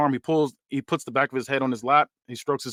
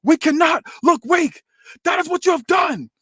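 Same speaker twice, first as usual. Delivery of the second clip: acted shout; 4 dB louder than usual. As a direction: shame him for weakness. Tony voiced scolding his son.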